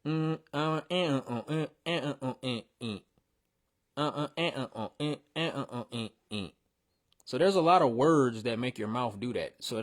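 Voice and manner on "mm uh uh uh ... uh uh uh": rhythmic "uh, uh" mumbles in the bar's cadence; scatting the rhythm wordlessly; mumbled syllables. Shown as a demonstration. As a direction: mumble the flow with no words. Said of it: "if I say that without the words, it would sound like".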